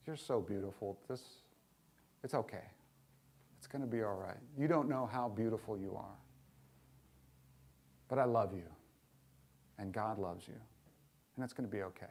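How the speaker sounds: put-on soft, low soothing to a crying child; tender comfort. Father soothing his crying little son, softly tender. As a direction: reassure him gently, softly.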